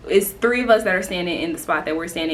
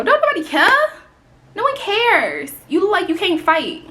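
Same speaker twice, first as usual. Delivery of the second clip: much higher, loud taunt; high mocking rant; 11 semitones higher, 4 dB louder than usual. Real, not acted. Ranting at the Chicago lady, high mocking taunt.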